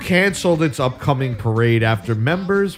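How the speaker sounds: solemn mock newscaster read; news read. Anchor gravely announcing parade cancellations, newscaster voice.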